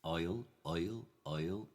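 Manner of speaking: "oil" for "I'll", with a rounded oy glide; original-pronunciation "oil", close to Irish. Shown as a demonstration. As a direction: repeat "oil" as a drill, Irish-tinged. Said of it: "the closest we, we have to oil is Ireland".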